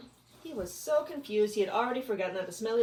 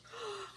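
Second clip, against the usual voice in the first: delighted gasp; 9 dB quieter than usual. Acted. Bug pleased gasp.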